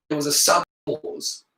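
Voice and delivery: plain reading voice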